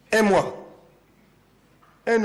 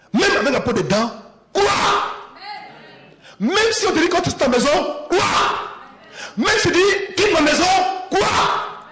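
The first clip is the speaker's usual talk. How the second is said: high, loud shouted crois; full-force preaching; 10 semitones higher, 5 dB louder, slower than usual. Acted. Preacher urging believers to keep believing, shouting. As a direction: hammer each crois home.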